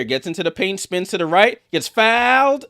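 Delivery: play-by-play rising to a high, punched finish; building excited call. Real, not acted. Commentator follows a drive to the foul, excitement building. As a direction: climb in pitch to a loud peak.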